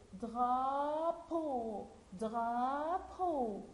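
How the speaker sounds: DRAPEAU with both vowels drawn out; slow teacher model of DRAPEAU; sounded-out word. Shown as a demonstration. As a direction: stretch the A and the final O of DRAPEAU. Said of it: "nous donne le son".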